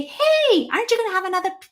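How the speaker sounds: high, coaxing dinner guest; mock party host. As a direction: imitate someone cheerily pushing seconds. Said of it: "who's going to go to Thanksgiving meal and say".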